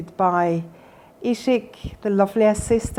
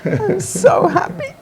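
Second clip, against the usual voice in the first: high, slow and teary with laughter; so happy she laughs and cries at once; laughing through tears; 11 semitones higher than usual. Real, not acted. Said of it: "She almost made me cry here".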